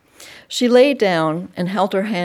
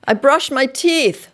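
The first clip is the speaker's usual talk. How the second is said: put-on child voice; 8 semitones higher, 5 dB louder than usual. A narrator's reading. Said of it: "she added".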